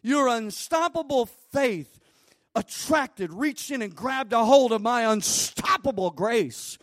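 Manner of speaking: loud, high preaching as Jesus; Jesus proclaiming loudly to the healed woman; full-force preaching. Acted. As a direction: proclaim healing, building to a shout.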